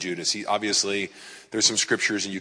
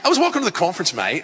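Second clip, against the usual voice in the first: hammed-up Aussie, much higher and louder; Australian accent; 8 semitones higher, 4 dB louder, faster than usual. Preacher showing off his impression of the host, Australian accent. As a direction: play up a high-pitched Aussie accent. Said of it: "I do a pretty good Australian accent".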